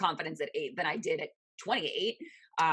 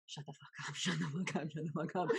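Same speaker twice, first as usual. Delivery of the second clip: much softer, muttered curse; muttered under breath; 8 dB quieter than usual. Retold or imitated. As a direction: grumble it to yourself. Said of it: "kept muttering under my breath".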